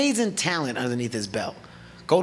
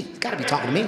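ordinary delivery

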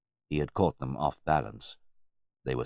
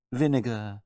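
deferential butler; 8 semitones higher, 4 dB louder than usual. A narrator's reading. Butler lectures his master.

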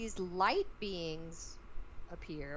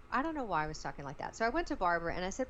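ordinary talk, nothing marked

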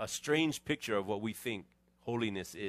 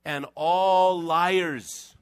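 very slow, louder, higher: And all liars; drawn-out emphasis; 4 semitones higher, 8 dB louder, much slower than usual. Acted. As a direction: stretch every word out loud. Dramatic scripture emphasis.

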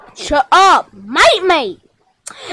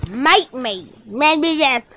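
quiet retort; 4 semitones lower, 7 dB quieter, slower than usual. Acted. Muted retort in a skit.

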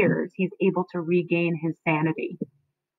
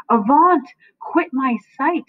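loud and higher, banishing the ghost; Avaunt! hurled out, then slower on quit my sight; shouted command; 6 semitones higher, 4 dB louder than usual. Acted.

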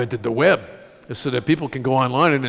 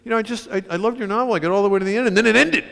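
a touch of a higher, miffed lady voice; upset reader; 9 semitones higher, faster than usual. Retold or imitated. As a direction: lightly imitate a disappointed reader. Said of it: "a lady who said".

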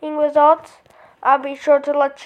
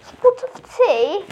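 slow, higher mock British "Spots of tea?"; hammed-up British accent; 6 semitones higher, slower than usual. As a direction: play a posh Brit offering tea. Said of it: "It changed to a British accent".

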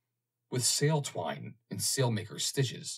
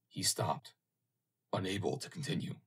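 plain reading voice

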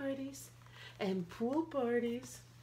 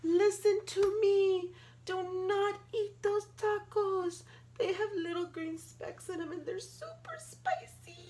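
higher, louder pleading; much higher, louder call to the dragons; urgent warning; 9 semitones higher, 5 dB louder than usual. Acted.

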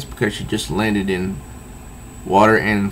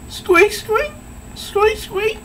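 very high squeaky mouse noise; mouse squeaks; slower than usual. Retold or imitated. Catwoman leaves a mouse, high squeaky mouse noise. Mouse left behind as calling card.

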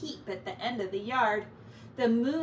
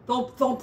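loud thumps; 6 dB louder than usual. A narrator's reading. Noise from wall narrated.

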